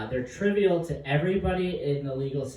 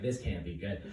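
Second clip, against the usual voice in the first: quiet dread; 8 semitones lower, 11 dB quieter than usual. Retold or imitated. Inner dread.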